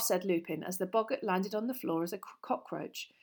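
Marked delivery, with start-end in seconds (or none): none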